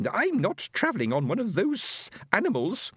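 high, slow alien voice; a lofty alien speaking slowly and high; stately high alien voice; alien Fenobe. Acted.

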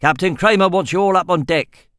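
barking orders. A narrator's reading. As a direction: bark it at the prisoners. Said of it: "he barked at them".

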